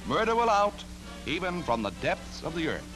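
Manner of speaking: ordinary talk, nothing marked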